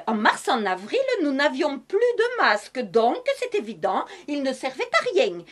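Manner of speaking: lightly put-on southern French accent with its sing-song lilt; a touch of southern French accent, hammed up for comedy. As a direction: play a cheerful Madame Masque with a hint of southern French accent. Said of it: "je continue avec cet accent du Sud".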